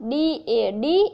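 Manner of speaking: careful, drawn-out teacher spelling; patient sounding-out for a learner; slow spelling out. Shown as a demonstration. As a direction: spell ડી એ ડી slowly letter by letter, then blend into ડેડ. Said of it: "એનું ઉચ્ચારણ થાય ડેડ".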